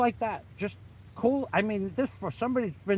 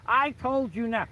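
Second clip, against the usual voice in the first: slow, raised-pitch retold insistence; insistent order; 7 semitones higher, slower than usual. Boss repeating his order to the bouncer, insistent. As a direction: lay down the order, high and drawn out.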